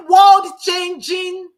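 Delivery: slow, high, loud proclamation; emphatic shout. Real, not acted. To her class, proclaiming her tantrum prayer, slow loud shout. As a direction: stretch it out and shout it. Bursts out mid-teaching.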